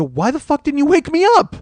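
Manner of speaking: high, loud indignant outburst; incredulous high-pitched yell; angry retort. Retold or imitated. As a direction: demand it, pitch jumping up loud. Retold quarrel at home.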